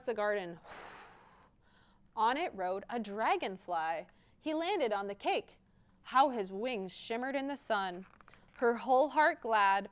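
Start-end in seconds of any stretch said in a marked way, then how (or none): none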